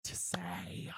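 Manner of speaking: words fade to a choked, breathless whisper; voice breaking. Real, not acted. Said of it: "sorry, I'm gonna get, like, emotional".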